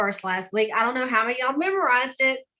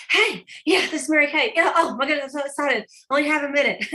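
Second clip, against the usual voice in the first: out-of-breath, excited phone greeting, pitched higher; Mary Kay, excited; 4 semitones higher, 3 dB louder, faster than usual. Retold or imitated. Mary Kay on the phone after running the stairs, breathlessly excited. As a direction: imitate a rushed, pumped-up caller. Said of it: "she seemed out of breath and excited".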